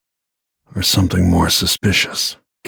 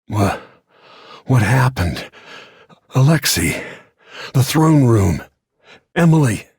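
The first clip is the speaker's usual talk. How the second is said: alarmed calling; 7 semitones higher than usual. Acted.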